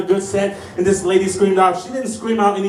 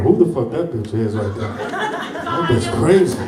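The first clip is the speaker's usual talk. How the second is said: imitating bewildered self; 8 semitones lower, slower than usual. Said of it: "I'm like".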